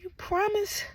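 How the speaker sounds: put-on high, soft, childlike asking; acted-out frightened patient; small pleading question. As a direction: ask timidly for reassurance. Acts scared patient seeking reassurance.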